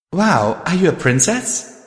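high, wide-eyed wonder; awed. A narrator's reading. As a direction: gasp it out in awe. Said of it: "she asked in awe".

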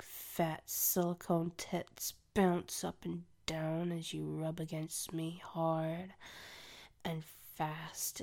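ordinary delivery

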